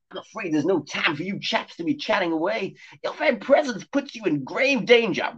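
high, prim cat voice warning of danger; posh talking cat. Acted. Cat warning the boys of danger, high and prim. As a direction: play the cat as a fussy gentleman.

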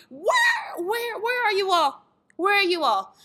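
high wailed mock desperation; exasperated pleading. Organizer calling for missing ticket buyers, exasperated. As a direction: cry out for the missing crowd. Acting out panic over no sales.

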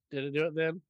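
normal talking voice

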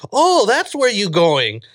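high, drawn-out imitation of mom catching on; mom's sudden realization. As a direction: mimic a mother who finally gets it.

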